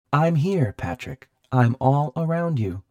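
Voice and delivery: high, loud sinister machine; techno house voice. A narrator's reading. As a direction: announce your presence loudly as the haunted house. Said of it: "the voice said".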